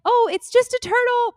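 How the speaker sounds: high-pitched mock all-clear; retold calling out. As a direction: pitch it way up, playing down a scare. Calls back to swimmers after screaming.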